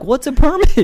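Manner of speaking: high, loud naive question; clueless question. Retold or imitated. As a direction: ask it totally clueless. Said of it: "I'm like".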